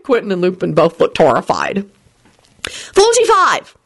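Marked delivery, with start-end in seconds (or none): 2.9-3.7 shouting. Acted. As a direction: shout it at him in a rush. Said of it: "she shouted at Lupin".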